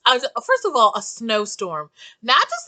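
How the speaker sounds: usual speaking voice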